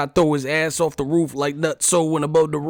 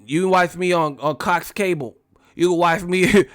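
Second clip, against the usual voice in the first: higher lisping boxer voice; Mike Tyson; 4 semitones higher than usual. Retold or imitated. Streamer retelling a cable promo outtake, boxer impression. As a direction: imitate the boxer introducing himself with a lisp. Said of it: "He was like".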